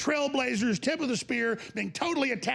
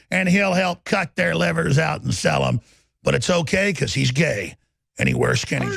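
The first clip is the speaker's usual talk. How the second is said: loud, relentless tirade at enemies; furious shouted rant; 4 semitones lower, 9 dB louder than usual. Real, not acted. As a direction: hammer every line at full volume.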